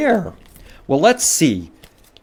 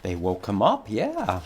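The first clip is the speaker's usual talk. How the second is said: ordinary delivery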